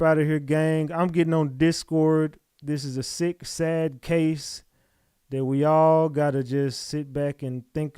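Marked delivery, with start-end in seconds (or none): none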